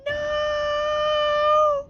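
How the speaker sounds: very high, loud cry of denial; anguished cry. Acted. Gabu learning his parents' fate, anguished cry. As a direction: scream in shock.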